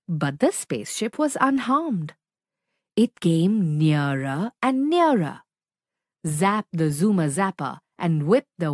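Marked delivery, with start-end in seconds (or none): none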